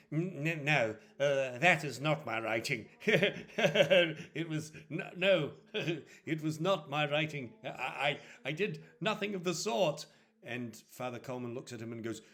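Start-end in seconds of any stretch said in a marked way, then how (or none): 0.1-10.0 stammered, lightly acted protest of innocence; flustered denial. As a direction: stumble over a hasty denial. Accused man stammers denial.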